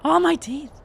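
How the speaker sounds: high, loud panicked cry, dipping midway; frantic wail. Acted. Game master voicing the doctor's panic, high frantic wail. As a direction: wail like a man losing his mind. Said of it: "He's like".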